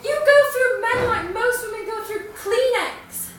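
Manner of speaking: Patricia. Acted. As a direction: build the accusation, hitting key words hard. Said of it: "I'll be performing in the character of Patricia".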